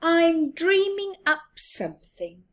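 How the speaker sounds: high squeaky mouse refusal; Little Mouse voice. Acted. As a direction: brush off the request, high. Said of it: "said Little Mouse".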